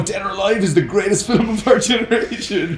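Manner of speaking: trying to keep a straight face and failing; laughing through it. Real, not acted. Podcast host mocking a bad movie, breaking into laughter. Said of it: "couldn't even get through that sentence without laughing".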